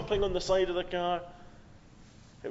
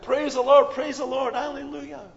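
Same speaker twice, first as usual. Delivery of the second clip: shouted praise; 7 semitones higher, 4 dB louder than usual. Shown as a demonstration. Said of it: "We shout".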